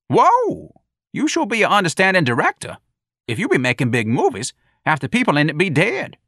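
high, loud sarcastic jeer; sassy mocking voice. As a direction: tease her with a high mocking voice. Jones mocks Lana.

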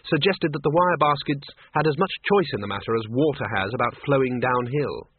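usual speaking voice

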